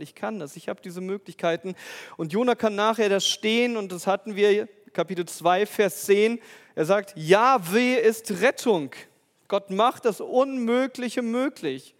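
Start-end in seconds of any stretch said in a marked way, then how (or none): 2.2-11.9 full-force preaching. Acted. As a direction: proclaim it loudly to the congregation. Preacher builds to climax. (laut und langsam predigend)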